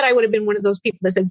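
usual speaking voice

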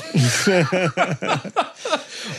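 helpless giggling after a flub; amused laughter. Real, not acted. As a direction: crack up over your own slip. Laughing at own flubbed list.

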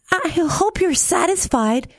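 put-on high-pitched child's reproach; lightly voiced tearful girl. A narrator's reading. Tearful to the frog in her pond, high reproach. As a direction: pitch it up as an aggrieved little girl. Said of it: "said Tearful".

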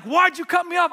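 high-pitched, loud accusing yell; angry yelling. Retold or imitated. Other driver accuses him through open window, angry high yell. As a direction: act out an irate driver yelling. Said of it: "He's yelling".

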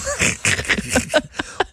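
high unvoiced laugh; a silent wheezing laugh; breathless laugh. Real, not acted. Laugh alone.

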